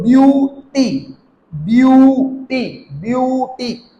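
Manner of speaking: ब्यूटी slowly, long यू and separate टी; long vowel demo. Shown as a demonstration. Teacher repeating for learners, slowly drawn out. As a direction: stretch ब्यू, pause, then टी in ब्यूटी. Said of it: "pronounce करेंगे यू".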